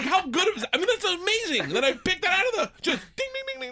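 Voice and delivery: high, giddy ding ding; gleeful gloat. Retold or imitated. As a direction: ring it like a prize bell.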